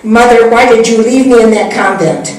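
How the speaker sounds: put-on confronting her friend as the deserted child; accusing child. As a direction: demand an answer from the mother who left.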